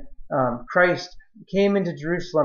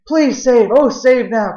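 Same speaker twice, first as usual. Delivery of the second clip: higher, louder cry of please save; pleading cry; 6 semitones higher, 5 dB louder, slower than usual. Acted. Preacher acting the Hosanna crowd, a loud high plea.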